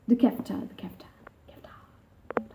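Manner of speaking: trailing off into a whisper; fading whisper. Real, not acted. Repeating kefta to herself, sinking into a whisper. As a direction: let kefta fade until it is whispered. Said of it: "I don't know what that was".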